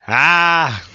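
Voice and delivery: sudden loud yell; shouting. Real, not acted. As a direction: blurt out a sharp yell. Reacting to late AI upload.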